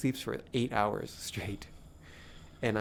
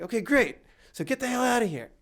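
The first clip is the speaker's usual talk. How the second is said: high, louder mock send-off; imitated sharp dismissal, pitched up; irritated dismissal; 11 semitones higher, 4 dB louder than usual. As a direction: act out shooing someone off angrily. Acted man sending teller away.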